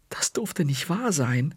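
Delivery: plain reading voice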